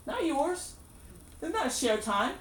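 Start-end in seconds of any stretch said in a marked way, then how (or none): 0.1-0.7 pitched high, pointed and firm; emphatic retort. Acted. The preacher asserting God's ownership, high and emphatic. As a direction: spike the words up to drive it home.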